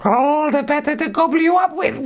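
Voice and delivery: very high, gleeful wolf voice; spring the trap with a squeal of delight; wolf unmasking. Acted. Wolf springing the trap on the girl: very high gleeful voice.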